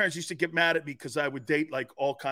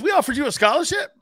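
loud coach; 5 semitones higher, 7 dB louder than usual. Retold or imitated. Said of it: "He goes".

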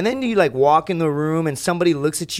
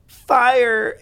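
mimicking partner's demand; 11 semitones higher, 7 dB louder than usual. Partner demanding he tend fire.

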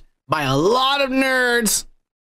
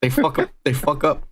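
ordinary talk

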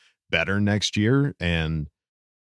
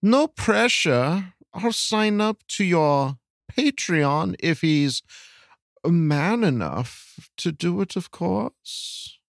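very slow, high put-on voice; high-pitched, stretched-out mock reading; put-on question reader; 11 semitones higher, much slower than usual. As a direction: read the listener's question in a high, drawn-out character voice. Reads listener's question in put-on voice.

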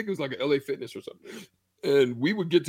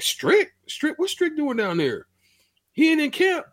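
shocked disbelief; 13 semitones higher, 3 dB louder than usual. Retold or imitated. Player shocked Strick missing camp.

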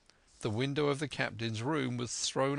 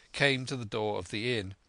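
usual speaking voice

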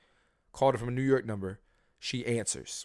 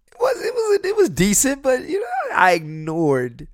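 much higher, loud mocking squeal; 14 semitones higher, 8 dB louder than usual. Mocking his own reply.

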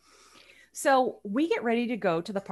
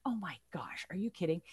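unvoiced whisper, much softer; whispered disbelief; 3 semitones lower, 11 dB quieter than usual. Narrator thinking it at the hostess's refusal, whispered. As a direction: say it under your breath in disbelief. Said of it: "I'm thinking".

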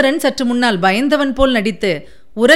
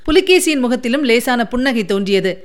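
usual speaking voice

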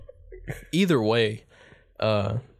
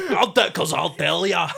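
higher mock Scottish; Scottish accent; 7 semitones higher, 3 dB louder than usual. Trading Scottish impressions, higher playful brogue. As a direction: toss it off in a brogue. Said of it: "when I do a Scottish".

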